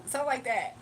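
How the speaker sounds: ordinary delivery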